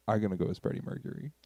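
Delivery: not speaking up. Real, not acted. Telling a bandmate a costume plan, too quietly. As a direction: say it too softly to be caught. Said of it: "you do have to speak up a little bit more".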